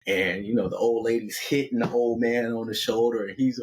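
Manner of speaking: usual speaking voice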